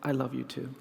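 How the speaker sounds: low, hushed and tender; imitated whisper. Little boy answering the one who hugged him, softly whispered. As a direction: say it very softly, as if into someone's ear. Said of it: "he whispered flawlessly in my ear".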